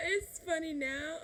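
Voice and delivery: high, loud, laughing through the words. Real, not acted. Vlogger amused looking back at it, laughing. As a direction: say it slowly through a real laugh. Amused aside about her parents.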